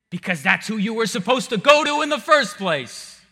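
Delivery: loud, high pulpit exhortation; preaching at full force. Acted. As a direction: urge them on at full voice.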